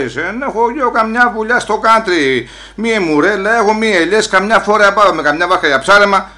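loudly listing what he owns back home; retold protest. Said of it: "Μη φωνάζεις έτσι".

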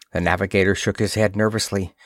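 ordinary delivery

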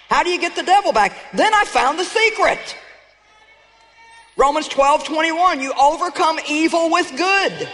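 rally the church like a victory cry; full-force sermon climax; fiery preaching. Acted. Building to triumphant preaching.

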